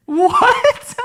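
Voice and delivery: high, squeaked what; surprised. Real, not acted. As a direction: thrown by the punchline. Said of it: "reacting to punchline".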